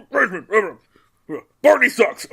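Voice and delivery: words blurted through loud fake coughs; cough-covered words. Ben hiding something under his breath, mock coughing. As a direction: cough out a hidden insult. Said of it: "hide something under his breath".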